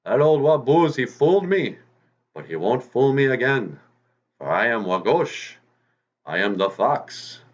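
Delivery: high, loud bragging fox voice; the fooled fox boasting he won't be fooled again; boastful fox. Acted. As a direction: crow it out like a proud hunter fox. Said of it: "and he goes".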